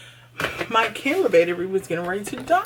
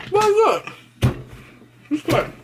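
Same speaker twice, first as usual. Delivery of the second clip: excited; 12 semitones higher than usual. Real, not acted. Excited about tasty chicken salad.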